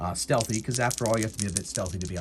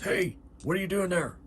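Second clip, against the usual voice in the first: high-pitched calling out as a guard; acted guard yelling to halt; guard's challenge; 9 semitones higher than usual. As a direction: challenge an intruder from a distance. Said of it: "The guard goes".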